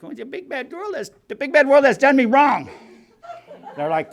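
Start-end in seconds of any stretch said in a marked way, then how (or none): 0.0-2.7 high, whiny mock complaint; whiny self-pity. Newcomer to old-timers, whiny self-pitying higher voice. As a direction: pout it like a sulking kid. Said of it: "I'm like".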